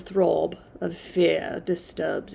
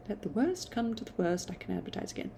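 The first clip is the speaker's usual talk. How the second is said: hushed private resolve; soft almost whispered retake; 10 dB quieter, much faster than usual. Shown as a demonstration. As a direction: drop to a near whisper on Let the worst come to the worst. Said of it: "second take, much softer".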